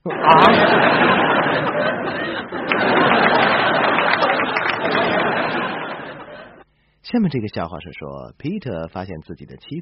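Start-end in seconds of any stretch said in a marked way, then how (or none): 0.5-6.4 long airy laughter; breathy laugh. Real, not acted. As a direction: laugh at the punchline. Laughs at punchline.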